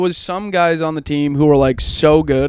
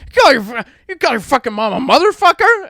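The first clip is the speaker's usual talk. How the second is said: high, sputtering indignation; outraged dad; 10 semitones higher than usual. Retold or imitated. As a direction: imitate a dad scandalized and furious. Acting out dad's breakfast outburst.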